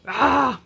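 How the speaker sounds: loud, high exasperated "ah"; frustrated groan. Real, not acted. Seller venting about refunds to viewers, frustrated cry. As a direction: let the frustration burst out wordlessly. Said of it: "you're seeing my frustration today".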